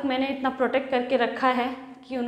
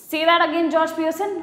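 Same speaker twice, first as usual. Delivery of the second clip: loud, higher, daring him; loud furious wife's challenge; angry challenge; 5 semitones higher, 5 dB louder than usual. Acted. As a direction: hands on hips, dare him to repeat it. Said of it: "गुस्से से एकदम उठ करके बोलती हैं".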